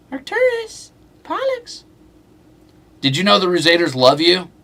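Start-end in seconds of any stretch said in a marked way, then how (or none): 0.1-1.8 very high pet-talk call to the cats. Real, not acted. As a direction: coo their names way up high. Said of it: "that voice means he's gonna get a treat".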